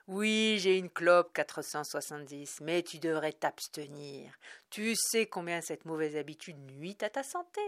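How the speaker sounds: loud, mock-preachy teasing; joking lecture. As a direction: tease him like a fake doctor. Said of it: "plaisanta mille sept cent quatre-vingt-neuf".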